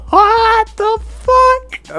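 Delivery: very high, drawn-out incredulous squeal; laughing disbelief. Real, not acted. Host reacts to a serial killer horror jacket, laughing disbelief. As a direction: squeal it slowly through helpless laughter.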